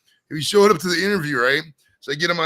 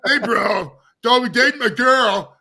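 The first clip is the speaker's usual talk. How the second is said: frat bro; 8 semitones higher, 6 dB louder, slower than usual. Retold or imitated. Frat guy voiced mockingly.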